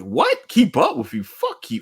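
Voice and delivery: outraged classmate shooting back; indignant comeback. Retold or imitated. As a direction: scoff back at him, offended. Mock reply to Deku's boast.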